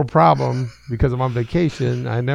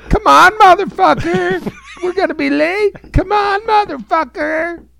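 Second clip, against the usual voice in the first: much higher, louder, slow impatient yell; American Jesus; 8 dB louder, slower than usual. Acted. Jesus hurrying Steve out of the toilet, high impatient yell. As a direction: holler at him like a fed-up buddy. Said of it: "That's the American Jesus".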